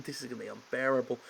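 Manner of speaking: usual speaking voice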